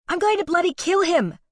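high, loud Aussie yell; furious shout. A narrator's reading. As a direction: scream it, ready to fight. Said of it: "Allie shouted".

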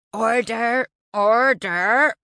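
loud bellowed call to order, rising; bellowing. A narrator's reading. Chairman calling six residents to order, bellowing. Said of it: "hammering his fist and bellowing".

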